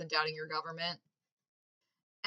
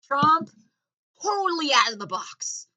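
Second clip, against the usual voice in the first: mock outrage; 8 semitones higher, 8 dB louder than usual. Mock outrage about Trump.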